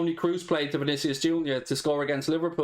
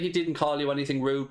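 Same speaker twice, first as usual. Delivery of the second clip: ordinary delivery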